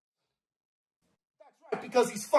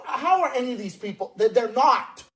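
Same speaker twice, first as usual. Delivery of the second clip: ordinary talk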